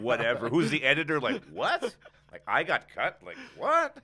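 loud, high, indignant disbelief; mock outrage. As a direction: play up being snubbed. Joking outrage at being cut.

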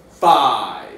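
loud, spaced-out counting; big slow game-show countdown; dramatic countdown. Pretend. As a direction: call each number out with a falling swoop. Countdown to the reveal.

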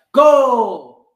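loud, high excited goal shout; goal call. A narrator's reading. Narrator calling the goal as BJ scores, excited.

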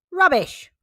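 sharp high-pitched scornful retort; growled dismissal. Acted. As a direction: cut the parents off with scorn. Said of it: "growled Doctor Dog".

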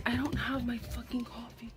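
soft unvoiced whisper. Real, not acted. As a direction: hush the grumble. Whispered complaint.

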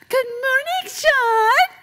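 very high, loud, piercing neighbor greeting; exaggerated high-pitched holler; Bob. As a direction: mimic an annoying neighbor in a squealing falsetto. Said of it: "That's how he talks".

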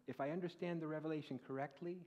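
normal talking voice

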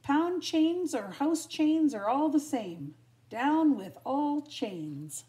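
high, loud rallying cry; drawn-out rebel shout. A narrator's reading. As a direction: chant it slowly like a slogan. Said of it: "cried the dogs".

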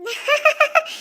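high, bashful little giggle; wife giggling shyly at his whisper. Acted. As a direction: giggle like a flustered bride. Wife reacts to love confession.

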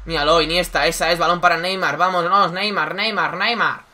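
excited play-by-play commentator; breakneck goal commentary. Acted. Mock football commentary of Neymar goal.

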